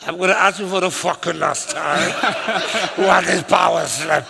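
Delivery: exasperated retold warning, breaking into laughter; mock frustration. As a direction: warn them, half laughing. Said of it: "so I was frustrated with them".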